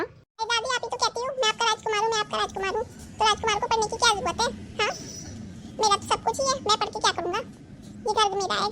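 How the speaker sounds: a spoilt prince mocking studies; bratty boy voice. Spoilt grandson talks back. (ऊँची ज़िद्दी बच्चे की आवाज़)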